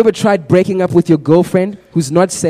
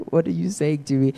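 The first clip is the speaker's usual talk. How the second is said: soft, stunned quote of a friend; devastated friend; 8 dB quieter than usual. Retold or imitated. Friend told they can't do this, quietly devastated. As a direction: imitate crushed disbelief, voice dropped in volume. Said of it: "like, devastated".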